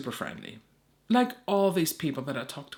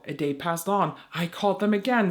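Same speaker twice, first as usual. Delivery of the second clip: ordinary talk, nothing marked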